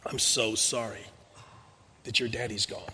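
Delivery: soft, low, hushed comfort; whispered condolence. Retold or imitated. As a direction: murmur it gently into his ear. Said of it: "whispered into his ear".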